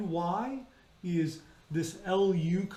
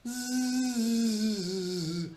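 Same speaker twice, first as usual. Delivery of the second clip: long buzzing "zzz" on a higher pitch; sung Z; 4 semitones higher than usual. Shown as a demonstration. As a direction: hold the voiced Z on one note. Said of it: "you can sing it".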